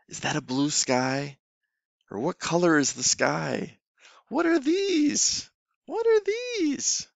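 put-on high, slow, sing-song questions to a small child; teacher voice for kids. Shown as a demonstration. Online teacher asking a young student, sing-song and high. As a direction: ask it like a playful teacher to a toddler. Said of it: "keep in mind".

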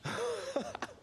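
high giddy starstruck laugh; high nervous fan giggle. Real, not acted. Starstruck fan meets Rich.

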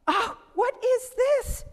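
an elder puzzled by the stick; high, curious elderly voice; old man surprised. Acted. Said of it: "the oldest man in the world came out".

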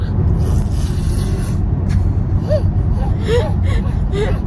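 loud breathy laugh; exasperated laugh. Real, not acted. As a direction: laugh it off in disbelief. After baby pooped.

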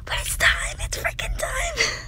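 hushed, breathless whisper of excitement; excited whisper. Real, not acted. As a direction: whisper it like you can barely contain yourself. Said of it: "I sounded like a drug addict".

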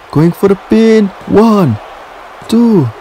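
high, tense count-along; excited pin count. Real, not acted.

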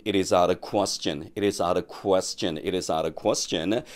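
"out of" run together as "ara", slow drill; linked fast pronunciation. Shown as a demonstration. Teacher drilling linked pronunciation for students, slow and linked. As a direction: say "It is out of question" with "out of" blurred into "ara". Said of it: "투 스텝으로 빨리 할 수밖에".

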